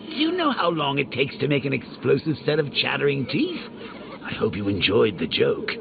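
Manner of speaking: ordinary talk, nothing marked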